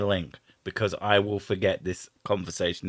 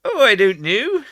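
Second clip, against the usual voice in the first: Cornish; 11 semitones higher, 6 dB louder than usual. Shown as a demonstration. Carrying on in the Cornish voice.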